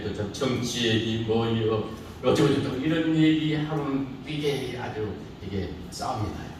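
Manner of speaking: ordinary talk, nothing marked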